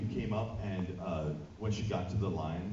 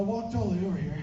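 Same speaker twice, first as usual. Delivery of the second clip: mimicking the fan; 11 semitones higher, 7 dB louder than usual. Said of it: "she's, well".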